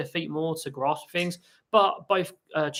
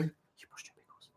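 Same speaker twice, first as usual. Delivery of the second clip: soft whisper; 15 dB quieter than usual. Real, not acted. Softly talking to the parrot.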